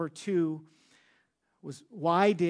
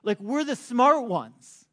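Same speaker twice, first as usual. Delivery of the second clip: louder, higher smug mockery; smug Pharisees; 4 semitones higher, 7 dB louder than usual. Pharisees boasting to the officers, loud and smug. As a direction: boast like the smart ones, then scoff. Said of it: "what they essentially are communicating, the Pharisees".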